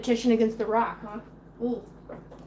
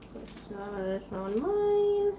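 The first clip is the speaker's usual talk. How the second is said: ordinary talk, nothing marked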